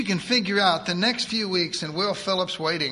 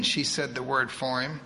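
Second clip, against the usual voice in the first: ordinary delivery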